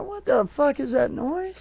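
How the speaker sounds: jolted awake by a weird noise; high, alarmed yell at a strange noise; startled. Retold or imitated. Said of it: "I'm like".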